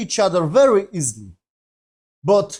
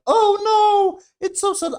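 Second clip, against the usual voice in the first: high, loud hammed-up whine of fake sorrow; mock melodramatic grief; 6 dB louder than usual. Reviewer mocking a sad scene, high hammed-up whine. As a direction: overplay the sadness in a squeaky voice to mock it. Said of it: "and she was like".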